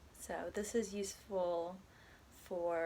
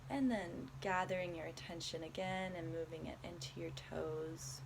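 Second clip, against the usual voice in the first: calm, unhurried body scan guidance with long pauses; guided meditation; 4 dB quieter than usual. Acted. Guiding a listener through a body scan, soft meditation voice. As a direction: ease the listener gently from one body part to the next.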